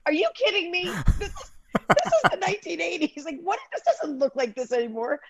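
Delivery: high-pitched, half-laughing complaint; mock indignation. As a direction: play an affronted tourist for laughs. Acts out visitors complaining, amused.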